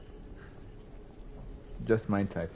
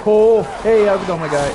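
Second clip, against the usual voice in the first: mock friendly greeting; 6 semitones higher, 7 dB louder than usual. Real, not acted. Greeting a scary enemy.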